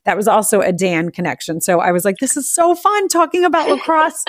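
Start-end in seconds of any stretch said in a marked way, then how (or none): 2.2-4.2 much higher, gushing; bubbly mock-gushing; excited. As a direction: squeal it with delight. Retold excited reaction.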